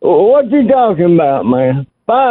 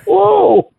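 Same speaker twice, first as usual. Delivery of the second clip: excited whoa; 11 semitones higher than usual. Real, not acted. Caller whooping at end of call.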